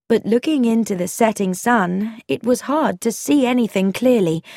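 ordinary delivery, nothing marked

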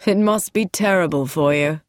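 understated grave sympathy; serious. A narrator's reading. Man sympathising with his sister-in-law, seriously. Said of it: "said Lance seriously".

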